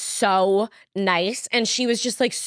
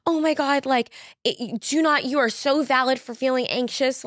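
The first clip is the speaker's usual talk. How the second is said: kind nurse; 5 semitones higher than usual. Retold or imitated. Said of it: "And she was like".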